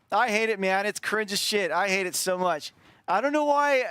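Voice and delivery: high, pained complaint; exasperated cringing. Real, not acted. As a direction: complain in embarrassment at how you sounded. Still cringing at own recorded voice.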